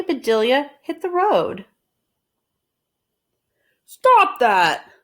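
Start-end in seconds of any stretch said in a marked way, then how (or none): 3.8-4.9 sharp loud shout to stop her. Acted. Mister Rogers yelling at Amelia to stop hitting the road, loud shout. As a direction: yell it like you're stopping a disaster. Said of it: "shouted Mister Rogers".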